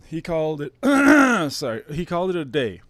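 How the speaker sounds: hammed-up fake grief, forcing the words out; mock choked up. To viewers about Cronin quitting, mock choked-up delivery. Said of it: "Sorry, I'm still fucking choked up".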